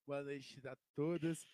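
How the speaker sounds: loud, slow announcer greeting; formal greeting. Real, not acted. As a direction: greet the audience slowly and loudly. Guest greets audience formally.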